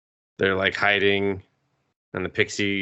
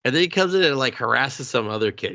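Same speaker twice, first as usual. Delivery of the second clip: usual speaking voice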